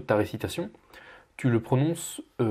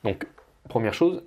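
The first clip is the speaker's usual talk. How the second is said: ordinary talk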